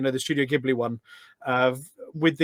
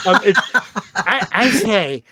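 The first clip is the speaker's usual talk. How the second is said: talking through a laugh, slow and higher; 6 semitones higher, 8 dB louder, slower than usual. Real, not acted. Guest laughing at the host's reaction, slow laughing words. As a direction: caught out and giggling.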